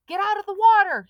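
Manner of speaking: high, loud panicked scream; boy screaming from the dock; screamed warning. A narrator's reading. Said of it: "he screamed".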